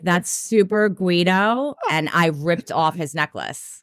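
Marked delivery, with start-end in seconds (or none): 0.0-1.8 slow, stretched teasing quip; mock Guido voice. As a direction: drawl out super Guido. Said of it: "I was like".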